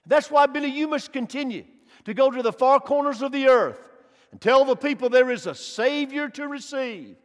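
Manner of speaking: usual speaking voice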